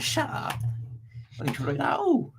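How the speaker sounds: mock Bristolian chav accent, jumpy pitch; sketch-show chav accent, pitch leaping up; Vicky Pollard. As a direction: put on a protesting West Country chav accent. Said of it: "a very Vicky Pollard accent".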